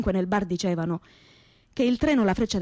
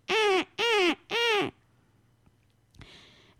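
much higher comic "eh, eh" calls; Ciccio's grunt to the crowd; 12 semitones higher, much slower than usual. Retold or imitated. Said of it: "aprì alla vucca e gridava alla folla". (richiamo comico molto acuto alla folla)

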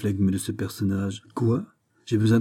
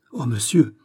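quick exclamation; 3 semitones higher, 5 dB louder than usual. A narrator's reading. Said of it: "s'écria vivement le comte".

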